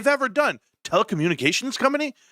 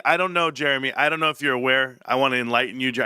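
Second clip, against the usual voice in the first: usual speaking voice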